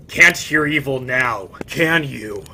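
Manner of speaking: loud whispered sneer; mocking taunt. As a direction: sneer at the dying man. Hero mocks the dead.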